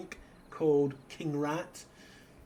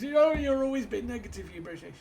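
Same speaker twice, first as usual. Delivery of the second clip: mock upset American; 10 semitones higher, 7 dB louder than usual. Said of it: "all upset because".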